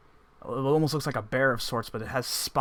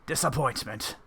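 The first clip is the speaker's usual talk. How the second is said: stern disappointed father; 2 semitones higher than usual. Acted. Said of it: "grits his teeth and he says".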